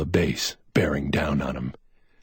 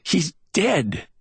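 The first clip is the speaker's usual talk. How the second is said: shaken; 13 semitones higher, slower than usual. A narrator's reading. Shaken man reports a death.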